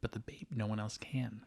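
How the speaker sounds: hushed secret aside; a soft unvoiced whisper. Real, not acted. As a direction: whisper it so nobody hears. Secret voice only audience hears.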